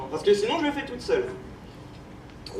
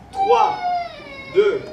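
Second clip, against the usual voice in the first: dramatic countdown; much slower than usual. Acted. Countdown to spell.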